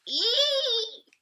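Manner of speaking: very high squealing Ee; pig squeal. Acted. Teacher voices the pig in the tub, squealing. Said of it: "the pig squeals".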